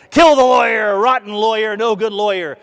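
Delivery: high jeering call; mocking crowd jeer. Speaker voicing the crowd jeering the lawyer, high mocking shout. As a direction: jeer like a rowdy mob.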